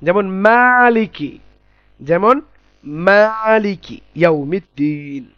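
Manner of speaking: মালিকি ইয়াউমিদ্দিন recited slowly in Arabic style; Quranic recitation demo. Shown as a demonstration. Teacher reciting মালিকি ইয়াউমিদ্দিন for students, slow and chanted.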